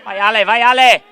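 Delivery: loud sideline yell; cheering shout. Real, not acted. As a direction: shout encouragement across the pitch.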